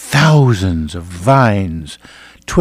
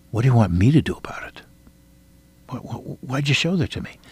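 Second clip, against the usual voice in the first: whispered, halting question to the Lord; whispered prayer; 3 semitones higher, 6 dB quieter, faster than usual. Retold or imitated. Preacher asking Jesus in prayer for years, whispered. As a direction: breathe the question, stammering.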